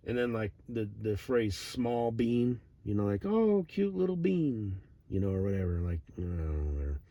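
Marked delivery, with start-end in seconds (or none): 3.2-4.8 higher, slow mock coo; lightly voiced baby talk; cooing at cute thing. Cooing over a small bean.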